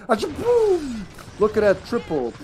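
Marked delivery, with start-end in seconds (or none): 0.1-1.0 high, loud playful boom noise; explosion sound effect. Pretend. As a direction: belt out a cartoon explosion. Mock explosion again.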